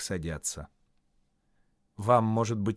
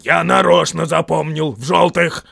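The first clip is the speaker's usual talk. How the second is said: loud, high troll insistence; insisting; 6 semitones higher, 12 dB louder than usual. A narrator's reading. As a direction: insist loudly that you are right. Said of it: "настаивал Берт".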